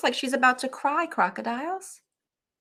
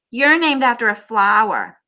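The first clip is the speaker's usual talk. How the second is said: loud mocking jeer; louder, mocking taunt; 12 dB louder than usual. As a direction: jeer at her name. Classmate taunts Chrysanthemum.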